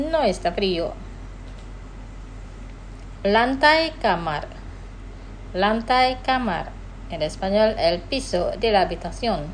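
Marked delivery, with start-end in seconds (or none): 3.2-6.7 "Lantai kamar" said slowly twice, syllables clear; slow model phrase. Shown as a demonstration. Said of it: "Los pronunciaré despacio". ("Lantai kamar" despacio y claro)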